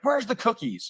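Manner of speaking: high, exuberant imitation; vibrant, vivacious. Actor imitating his coach bursting through the door, vivaciously. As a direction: burst in with a high-pitched, lively call. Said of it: "very vibrant, very, like, you know, vivacious".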